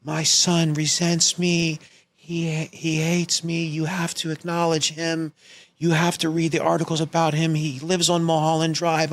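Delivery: hammed-up, pleading mom on an answering machine; Oscar-worthy melodrama. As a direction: imitate a mother's dramatic voicemail performance. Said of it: "a Oscar-worthy performance".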